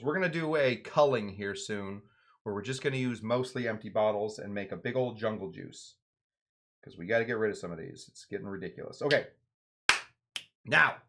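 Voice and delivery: ordinary delivery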